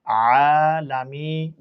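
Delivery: drawn-out আলামি, syllable by syllable; slow blended syllables. Shown as a demonstration. Teacher to pupils, slowly blending আলামি. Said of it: "আইন কাটা জবর আ".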